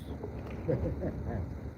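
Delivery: soft breathy chuckle. Real, not acted. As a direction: laugh quietly at your own joke. Laughs at own joke.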